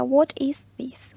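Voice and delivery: very slow What is this, spoken aloud; drawn-out teacher model, spoken aloud; slow model question. Shown as a demonstration. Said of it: "Yo les había presentado la pregunta". (pregunta modelo lenta, en voz alta)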